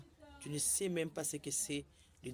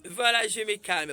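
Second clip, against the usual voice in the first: louder and higher, protesting calm; loud mock self-calming; calming down, said loudly; 4 semitones higher, 10 dB louder than usual. Shown as a demonstration. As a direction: announce your calm with heat. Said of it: "le pasteur Barouti qui dit calme".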